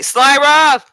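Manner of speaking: high, loud holler for someone upstairs; calling out. Acted. Opening the door to summon Slyra, calling out. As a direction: yell the name through the house.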